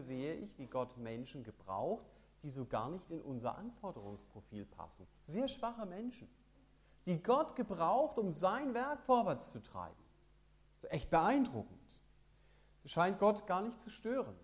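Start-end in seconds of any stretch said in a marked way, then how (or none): 5.2-14.4 predigen. Acted. Predigt mit voller Kraft.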